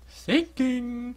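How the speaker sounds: strained high character read; failed try at a game voice; high put-on voice. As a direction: pitch it way up. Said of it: "I can't do that".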